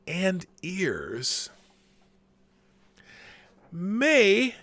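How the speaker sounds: slow, drawn-out sarcastic hint with long pauses; heavy sarcasm. Real, not acted. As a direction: dangle each phrase like a knowing wink. Sarcastic hint about Biden.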